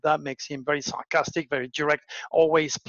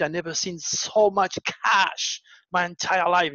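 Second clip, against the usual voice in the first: normal talking voice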